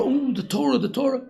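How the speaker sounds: a sighing, mock-rapturous coo; mock gushing. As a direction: imitate starry-eyed excitement, soft and high.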